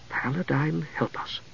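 very soft, slow plea; hushed prayer. A narrator's reading. Priestess quietly praying after the knight's honesty, hushed. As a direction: breathe a tiny prayer.

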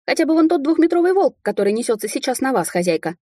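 high-pitched raccoon bark, easing off at the end; agitated animal sidekick barking. A narrator's reading. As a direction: snap out an urgent warning as the raccoon. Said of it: "рявкнул енот".

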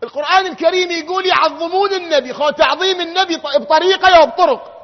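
fiery Friday sermon voice; preaching at full force. Acted. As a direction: drive each question home then answer it. Preaching call and answer.

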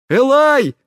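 high, loud call of a name; urgent high-pitched shout. A narrator's reading. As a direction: burst in yelling for someone. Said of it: "с криком влетел в каюту Тина".

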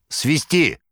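brisk high order; sharp command. A narrator's reading. Manager ordering the doorman, sharp command. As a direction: order the whistle. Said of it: "скомандовав".

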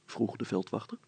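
ordinary delivery

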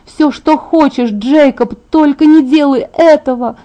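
high, desperate call; crying after him. A narrator's reading. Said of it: "закричала я ему вслед". (отчаянно, высоко кричит вслед)

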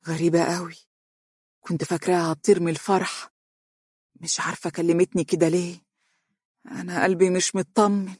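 understated, uneasy puzzlement; mildly baffled musing; puzzled wonder. A narrator's reading. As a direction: wonder aloud, a touch troubled. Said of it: "وتقول متعجبة".

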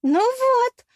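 very high, loud gloating cry; ликующе. A narrator's reading. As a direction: crow in triumph to the whole crowd. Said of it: "возликовала русалка". (ликующий возглас)

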